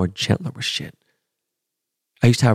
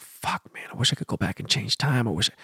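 breathy, much softer, almost a whisper; regretful murmur; 9 dB quieter than usual. Retold or imitated. Remembering the pain he went through, a hushed regretful murmur. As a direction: let the regret slip out under your breath.

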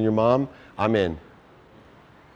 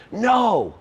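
mock yell, much higher; retold scream; 10 semitones higher than usual. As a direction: snap a sharp warning at a child near fire. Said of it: "screamed at me".

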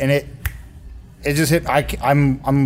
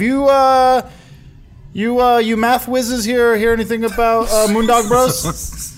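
put-on drunk character voice; 10 semitones higher than usual. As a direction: stretch it out like you're tipsy. Character asks the locals.

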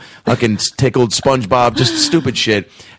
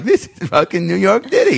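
mock tourist; 9 semitones higher than usual. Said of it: "people are walking through being like".

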